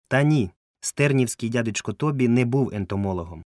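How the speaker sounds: plain reading voice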